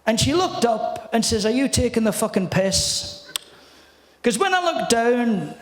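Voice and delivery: Scottish comedian telling an anecdote; storytelling impression of a Scottish comic; Billy Connolly. Shown as a demonstration. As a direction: spin the yarn as a Scottish stand-up. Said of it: "I'm gonna do it in your voice".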